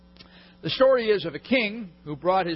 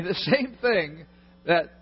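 ordinary delivery

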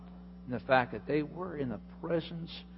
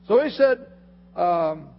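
ordinary talk

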